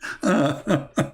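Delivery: short real chuckle; brief amused giggle; chuckling. Real, not acted. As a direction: let a last laugh escape. Still amused by his story.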